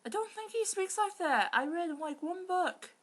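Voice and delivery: high, loud, baffled protest; incredulous rant. Real, not acted. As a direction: complain at the absurd plot. Exasperated reader commentary.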